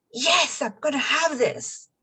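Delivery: high, emphatic burst of determination; fired-up conviction. Acted. Coach voicing how the affirmation feels in your body, eagerly determined. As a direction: exclaim it like you already feel it. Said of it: "it brings that kind of".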